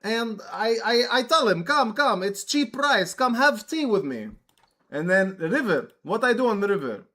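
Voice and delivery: a hint of a Russian host offering tea; Russian dude voice. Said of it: "Russian dude voice".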